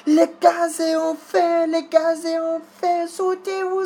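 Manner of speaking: high, frantic yelling in French; retold panicked screaming. Neighbors yelling that the gas is on fire, panicked high screams. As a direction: shriek the warning like panicked neighbors. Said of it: "And everybody's screaming".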